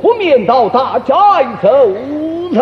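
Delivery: Peking opera stage speech, long-held endings; opera recitation. Shown as a demonstration. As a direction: draw the last word out operatically. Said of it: "道白都这么道白".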